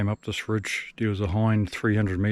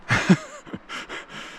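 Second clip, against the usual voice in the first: breathy laugh. Real, not acted. Laugh after a near fall.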